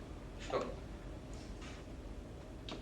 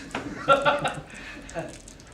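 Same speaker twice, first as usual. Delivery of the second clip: airy high laugh; breathless laugh; 8 semitones higher than usual. Acted. Man laughing at his own suit, airy laugh.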